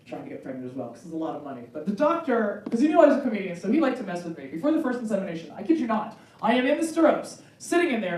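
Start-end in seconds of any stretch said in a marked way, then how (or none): none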